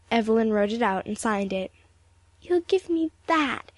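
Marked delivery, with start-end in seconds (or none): 2.4-3.7 high, slow tearful plea; pleading. A narrator's reading. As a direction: beg for it, drawn out and pitched up. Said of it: "she pleaded".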